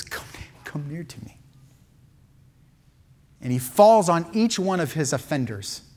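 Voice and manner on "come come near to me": whispered, soft invitation; tender whisper. Acted. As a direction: breathe it out gently, like calling them close. Joseph calls brothers near.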